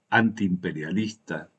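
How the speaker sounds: normal talking voice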